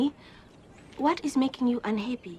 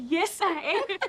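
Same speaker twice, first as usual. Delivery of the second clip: high mock admission in a put-on voice; mock reply; 11 semitones higher, 4 dB louder than usual. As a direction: squeak it out like a glum confession. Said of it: "you would have to say".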